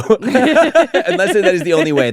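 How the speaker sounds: slow, laughing tease. Real, not acted. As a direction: laugh through the words. Teasing friend.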